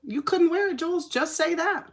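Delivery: high, mocking voice telling herself off; mock self-scolding. Telling herself Jules she couldn't wear it, high mock scold. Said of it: "I just had to say that".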